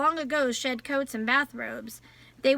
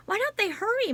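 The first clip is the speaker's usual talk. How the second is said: repeated even higher and a touch more urgent, falling at the end; more anxious; 9 semitones higher, faster than usual. A narrator's reading. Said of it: "muttered Jem".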